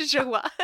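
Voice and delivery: "Jaguar" as ZHUG-wah; sketch pronunciation. Acted. Echoing a comedy sketch, affected ZHUG-wah. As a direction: soften the J, drop Jaguar's final r. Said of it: "comedy sketch that's like".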